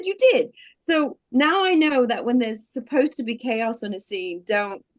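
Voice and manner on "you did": high, insistent retold comeback. Co-star contradicting her denial about the accent, insistent. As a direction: imitate a co-star firmly contradicting you.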